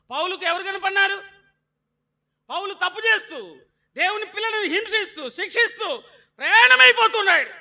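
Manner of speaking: high, loud shouted sermon; full-force pulpit shout; fiery preaching. Acted. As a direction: drive it home to the church.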